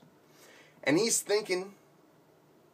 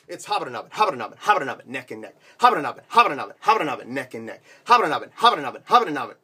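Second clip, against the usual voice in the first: louder rapid race-caller chant; excited race call; 5 dB louder, much faster than usual. Acted. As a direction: call the neck-and-neck finish like an announcer. Horse race call, neck and neck.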